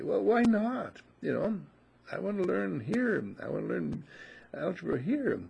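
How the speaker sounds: student pleading with the music school to teach him, high and whiny. Acted. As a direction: beg to be let in.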